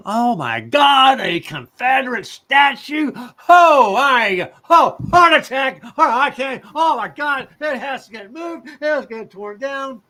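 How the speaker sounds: loud, high-pitched pearl-clutching shriek; mock hysterical outrage. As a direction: overdo a horrified passer-by having a meltdown. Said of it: "some people walk by it".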